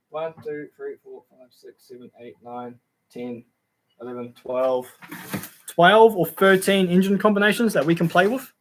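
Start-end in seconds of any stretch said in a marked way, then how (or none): 0.1-4.9 very quiet, lower-pitched count to oneself; tally softly, half to yourself; counting under breath. Real, not acted. Streamer tallying engines on a list for viewers, counting under breath.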